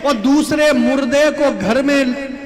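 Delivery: high, loud, forceful challenge; indignant preaching. Real, not acted. Preacher challenging listeners who eat meat, indignant.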